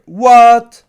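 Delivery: loud, high shocked cry; character hears she is not welcome, shocked shout; shocked what. Retold or imitated. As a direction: shout it in sudden disbelief. She reacts to not being welcome.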